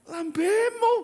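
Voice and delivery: mock scolding. Scolding phone-addicted listeners.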